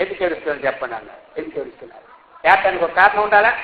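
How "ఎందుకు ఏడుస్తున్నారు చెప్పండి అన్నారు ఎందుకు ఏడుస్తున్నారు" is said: low, very soft voice of the dead man; quietly imitated questioning; hushed quoted question. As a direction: ask it low and under your voice.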